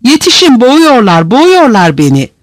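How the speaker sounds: high, panicked cry; anguished cry for help. A narrator's reading. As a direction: cry out as if being choked. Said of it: "acı bir çığlık yükseldi".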